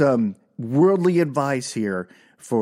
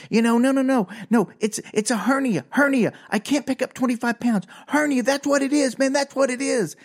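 high, agitated insisting; mock frantic rant; retold crazy yelling; 7 semitones higher than usual. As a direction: plead your case in a worked-up, high voice. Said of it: "kinda yelling and being kinda crazy".

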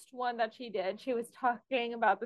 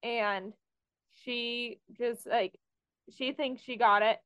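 normal talking voice

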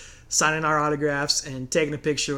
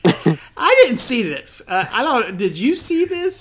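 laughing disbelief; 6 semitones higher, 6 dB louder, slower than usual. Real, not acted.

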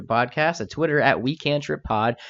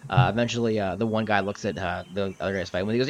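ordinary talk

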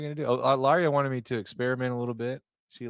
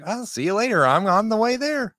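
excited; 12 semitones higher, 8 dB louder than usual. Retold or imitated. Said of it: "I was like".

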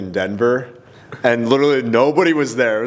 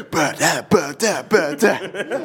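high, laughing vocal riff imitation; imitated rap ad-libs; 7 semitones higher, slower than usual. Host imitating a performer jumping into the set, laughing rap noises. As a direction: mimic rhythmic rapper noises, giggling. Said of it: "jumps right into just".

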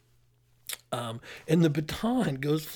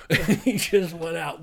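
laughing; 3 semitones higher, 7 dB louder, slower than usual. Real, not acted. Laughing at own story.

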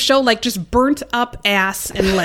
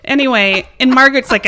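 ordinary delivery

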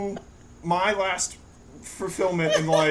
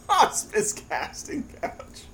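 breathy laugh; 10 semitones higher, slower than usual. Real, not acted. Repeats joke through laughter.

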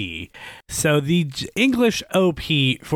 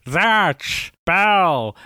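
mimicking an electronic theme-song shout-out; imitated electronic voice; 5 semitones higher than usual. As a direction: punch out each word like a synthetic jingle voice. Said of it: "that weird electronic Zatch Bell".

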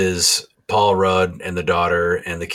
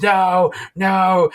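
very high, louder hammed-up cry of no; mock scream; 14 semitones higher, 5 dB louder than usual. Loomis screaming at the cult mark, high loud mock cry. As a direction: wail it like a horror-movie victim. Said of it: "he screams".

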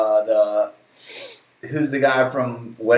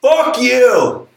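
mock shout; 9 semitones higher, 4 dB louder than usual. Mock insult at listener.

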